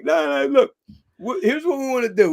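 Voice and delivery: smooth him over; hammed-up persuading host; wheedling host. Host pitching the super fan idea.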